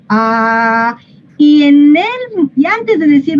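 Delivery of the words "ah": "ah" held long with a round, open mouth; long, level, rounded "ah". Shown as a demonstration. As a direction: open wide and sustain a pure A on "ah". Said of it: "abro la boca, la dejo redonda".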